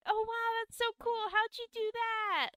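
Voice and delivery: put-on very high, awestruck child voice; amazed little kids. Kids watching a shapeshifting trick, high-pitched amazement.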